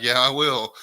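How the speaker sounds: loud, cocky fake promise; sarcastic. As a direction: promise it with no intention. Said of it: "And I'm like".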